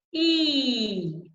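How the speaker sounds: long held "E" gently gliding down; high-tongue vowel. Shown as a demonstration. Coach showing tongue placement on "E", long and pure. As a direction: sustain "E" with tongue blades high, tip down. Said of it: "Tip of the tongue is down".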